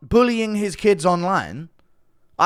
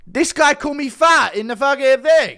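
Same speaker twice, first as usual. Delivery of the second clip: higher, louder mock-offended complaint; indignant; 8 semitones higher, 3 dB louder than usual. Real, not acted. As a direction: play the wounded victim.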